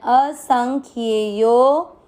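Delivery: असंख्येयो with the final यो drawn out long. Shown as a demonstration. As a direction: stretch the यो in असंख्येयो. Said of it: "यो की मात्रा को लंबा खींचना है". (असंख्येयो में यो लंबा खींचकर)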